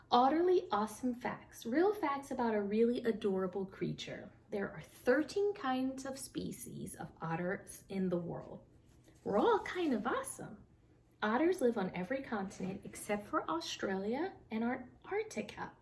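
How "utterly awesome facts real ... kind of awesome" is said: plain reading voice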